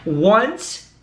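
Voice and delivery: usual speaking voice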